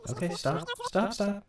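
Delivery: sharp rising shout of stop; urgent stop. Real, not acted. Editor yelling at the audio to stop playing, loud and urgent. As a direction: halt the playback right now, loud and high. Editor halting playback abruptly.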